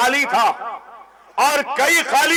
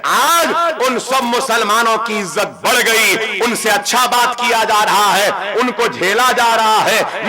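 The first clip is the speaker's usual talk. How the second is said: ordinary talk, nothing marked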